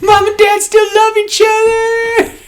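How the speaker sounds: put-on very high, slow, loud delighted child; overjoyed kid. Liam realizing his parents still love each other, high joyful squeal. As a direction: squeal it like a relieved little boy.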